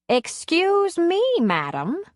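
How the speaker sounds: mock offended, drawn up high; haughty indignation, slow and lofty; indignant. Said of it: "Addie said indignantly".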